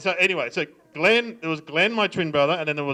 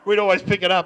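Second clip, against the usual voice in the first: ordinary talk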